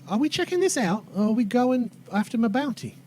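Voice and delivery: put-on accented woman pressing the group; Tracy. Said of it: "Tracy kinda speaks up".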